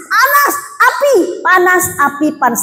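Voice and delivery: high, wailing cries of a woman burning; retold scream of a woman in torment; mock anguished screaming. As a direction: wail it like she feels herself on fire, half-acted. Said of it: "teriak-teriak".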